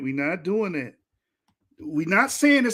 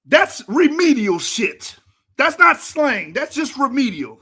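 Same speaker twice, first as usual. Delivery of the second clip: exasperated scolding; 3 semitones higher, 6 dB louder than usual. Real, not acted. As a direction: lay into him, hammering each word. Scolding the speaker for his pronunciation.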